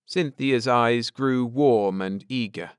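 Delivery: usual speaking voice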